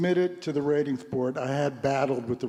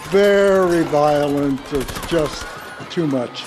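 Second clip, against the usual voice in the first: slow, loud, each word leaned on; emphatic; 8 dB louder, slower than usual. Real, not acted. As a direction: hammer home "Very violent". Stressing how violent the film was.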